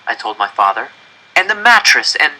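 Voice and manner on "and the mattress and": put-on high, eager child's voice selling the idea; eager pleading. Janek urging his parents to move into the coop, eager. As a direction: convince them, bursting with hope.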